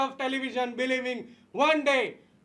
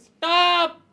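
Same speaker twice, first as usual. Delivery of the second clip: mock yell; 6 semitones higher, 9 dB louder than usual. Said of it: "If a person yells".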